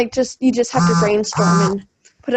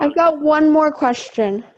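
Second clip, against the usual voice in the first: while brushing teeth; 3 semitones higher, slower than usual. Real, not acted. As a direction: mouth full of toothpaste. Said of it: "I'm kind of brushing my teeth".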